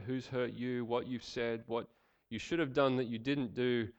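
ordinary delivery

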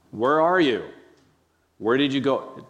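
loud, high accusing call; harsh demanding tone. Shown as a demonstration. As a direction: bark it out like an angry judge. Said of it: "This is not the tone".

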